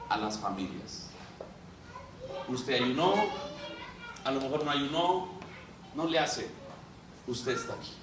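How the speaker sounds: low, soft pastoral appeal to the congregation; soft preaching. Acted. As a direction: lean in and speak gently to the church. (predicación suave y baja a la congregación)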